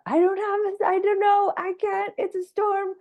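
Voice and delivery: a squeaky overwhelmed wail; panicky high whine. Pretend. Telling her viewers how she'll sound when overwhelmed, a high panicked whine. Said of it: "I'm doing the".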